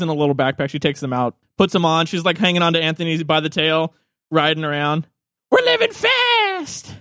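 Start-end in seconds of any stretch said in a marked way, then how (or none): 5.5-6.9 high, slow, wild whoop; excited shout. Acted. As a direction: yell it with reckless joy. Pellbottom rollerblading wildly.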